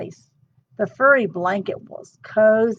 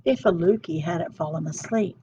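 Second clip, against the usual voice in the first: ordinary delivery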